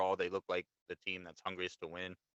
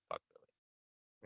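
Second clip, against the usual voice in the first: quiet aside, barely voiced for others; mumbled under breath; 10 dB quieter, much slower than usual. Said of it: "I mumbled under my breath".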